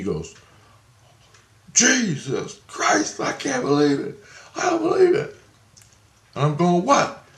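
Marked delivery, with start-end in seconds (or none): 1.7-5.4 flipped out. Retold or imitated. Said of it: "and he flipped out". 6.8-7.2 high, puzzled what; bewildered what. Retold or imitated. Asking, bewildered.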